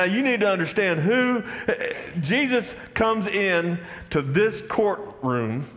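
normal talking voice

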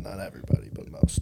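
whispering. Real, not acted. Joking aside, taking back insult.

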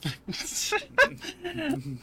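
high, breathy burst of giggles; real high-pitched laughing fit; burst of laughter. Real, not acted. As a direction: crack up laughing. Laughing at the suggested name.

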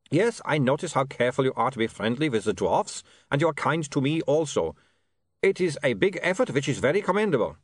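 put-on polite, reassuring vampire with a foreign accent; courteous vampire Otto. Otto thanks William for the job, courteous vampire voice.

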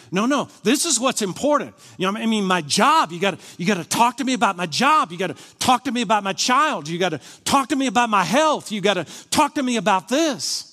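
loud, high, piled-up demands; insistent demanding prayer. Acted. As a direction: hammer each repeated demand at God. Acts out insistent demands to God.

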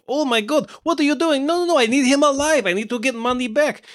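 put-on high, panicked foreign-accented voice; hammed-up accented villain alarm; freaking-out villain. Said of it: "And he's freaking out".

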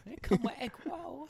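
high mock broken-English accent; mock foreign accent. Friends trading a meme line, mock foreign accent.

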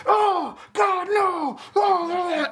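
high, drawn-out mock wail; hammed-up anguished moaning; Peter Parker. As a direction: wail it way up high, stretching each word. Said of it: "puts his head in his arm and he's like".

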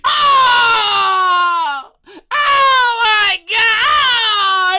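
high, loud, drawn-out wail of outrage; outraged cry. Acted. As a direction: cry out like the crowd is robbed. Acted crowd outrage at a score.

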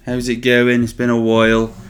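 loud, high hammed-up Cornish greeting; Cornish accent. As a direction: greet the listeners like a Cornish local. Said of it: "reason I'm talking like this".

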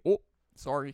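put-on Canadian accent. Cohost imitating someone bumping into you, Canadian accent. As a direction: apologize with a Canadian vowel. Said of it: "That's Canadian".

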